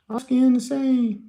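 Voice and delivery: high-pitched put-on boy voice; mock squeaky young patient; teenage boy's voice. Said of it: "boy who talked like this".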